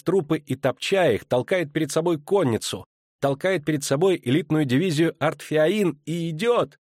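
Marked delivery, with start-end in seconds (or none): none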